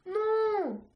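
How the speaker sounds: desperate scream, high and crying. A narrator's reading. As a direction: scream it in despair. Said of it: "hurla F-, Fjord".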